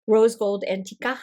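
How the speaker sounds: ordinary talk, nothing marked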